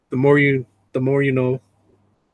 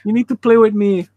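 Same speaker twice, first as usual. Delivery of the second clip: higher-pitched needy doggy voice; dog's imagined voice; 7 semitones higher than usual. Acted. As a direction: voice a pestering dog, pitched up. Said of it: "She's like".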